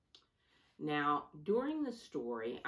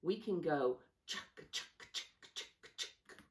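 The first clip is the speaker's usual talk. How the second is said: quick whispered chuck chuck; faster train chugging. Shown as a demonstration. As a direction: speed the chugging up, breathy. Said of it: "if we want our train to go faster".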